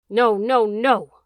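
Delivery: loud, wailing howl of refusal. A narrator's reading. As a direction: howl it out in full voice. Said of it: "the dog howled".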